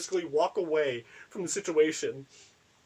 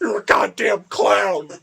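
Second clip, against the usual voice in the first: louder, higher vicious shout; aggressive yell; 6 semitones higher, 11 dB louder, slower than usual. Retold or imitated. In the sketch, screaming the insult at Trevor. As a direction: spit the insult out at full volume. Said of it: "So loud and so aggressive".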